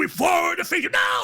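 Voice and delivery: very high, wailing battle cry; screaming ritual cry. Acted. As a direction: scream it out as a mock ritual curse.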